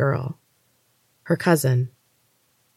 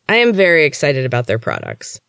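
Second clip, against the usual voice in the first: plain reading voice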